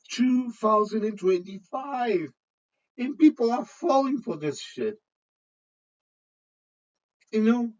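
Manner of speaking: high, loud, amused disbelief; incredulous, voice jumping way up with amusement; laughing. Real, not acted. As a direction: exclaim it on the edge of real laughter. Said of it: "I'm sorry to be laughing".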